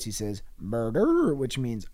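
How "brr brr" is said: ordinary delivery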